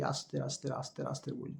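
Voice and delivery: very soft, low, drawn out; teacher showing too-slow speech, soft and dragging; slow demo. Shown as a demonstration. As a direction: drag each word to show slowness. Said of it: "খুব কম স্পেসে বলি".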